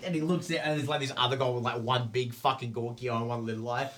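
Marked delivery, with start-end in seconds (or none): none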